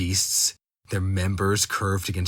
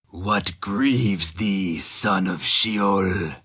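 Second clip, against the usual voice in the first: very slow, strange, solemn creature voice; old creature asks the man what grieves him, slow and strange; ancient creature voice; 3 dB louder, much slower than usual. Acted. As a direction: draw each word out like something ancient. Ancient creature speaks in story.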